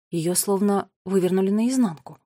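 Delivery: ordinary delivery, nothing marked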